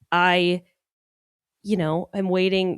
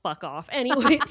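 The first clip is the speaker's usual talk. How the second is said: ordinary delivery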